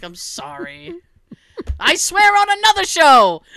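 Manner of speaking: loud, high mock protest; playful shout. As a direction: shout it out, half joking. Retelling own reply to husband.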